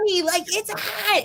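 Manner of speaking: put-on high whiny child voice; whiny kid complaint. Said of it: "and we'd be like".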